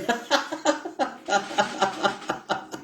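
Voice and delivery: long high simulated laugh; fake laugh. Shown as a demonstration. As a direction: laugh along on purpose. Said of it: "Commençons à faire des rires simulés".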